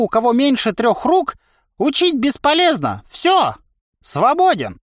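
high, emphatic veteran's tirade; boastful old trainer. A narrator's reading. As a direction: lecture grandly and scornfully at a high pitch. (хвастливый старый вояка, высоко и с напором)

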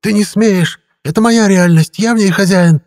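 high, loud outraged creature; very high-pitched raging protest; indignant shouting. A narrator's reading. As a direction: assert ownership in a furious high cry. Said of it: "негодующе крикнула тварь".

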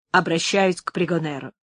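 ordinary delivery, nothing marked

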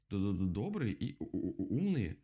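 slow stutter on first sounds. Acted. Woodpecker insists owls are kind, stuttering. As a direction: stick on the consonant, then get it out.

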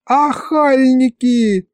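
high, drawn-out indignant cry; yelled insult. A narrator's reading. Angry wife berating two sorcerers, mock yell. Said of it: "снова заорала на них Варя".

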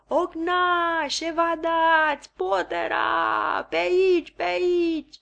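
high, loud slow calls for the guards; distant shouting. A narrator's reading. A second voice calling the guards, high stretched shouts. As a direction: drag out each call like someone shouting for help. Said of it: "Iar alta".

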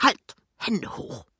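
mock hold-up challenge; calling out. A narrator's reading. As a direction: call out like a sudden ambush. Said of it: "eine Stimme ruft".

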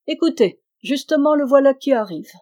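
sudden call to listen; old woman warns of her son's approach; urgent alert. Acted. Old woman hears son coming.

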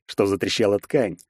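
ordinary delivery, nothing marked

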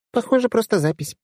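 put-on high, offhand girl voice; lightly voiced casual girl; shrugging girl. A narrator's reading. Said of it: "пожала плечами Машка".

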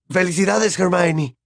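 high, over-eager congratulations; effusive. A narrator's reading. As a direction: gush in a voice not quite your own. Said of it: "tan efusivamente que no parecía su voz". (felicitación efusiva, con voz aguda)

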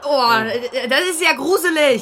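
mock shudder. [name] reacts to coffin news.

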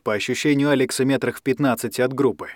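usual speaking voice

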